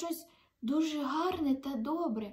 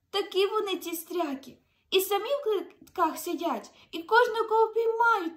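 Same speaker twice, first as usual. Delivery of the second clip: high, loud, rising indignation; agitated complaint; 7 semitones higher, 7 dB louder than usual. Acted. Snail tells Giovanni of the captors, agitated. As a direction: snail gets worked up about the captors.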